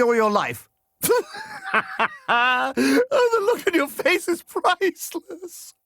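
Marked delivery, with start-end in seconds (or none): 1.0-2.2 high, gleeful cackle; gleeful laugh. Acted. Prankster laughs at a victim's face. 2.3-5.7 high, drawn-out words through laughter; tickled by your own prank; laughing gleefully. Acted.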